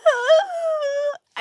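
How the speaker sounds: high sharp mock sob gasp; hammed-up imitation of a crying gasp; imitating a crying gasp. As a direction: mimic her crying with one big gasp. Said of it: "she was like".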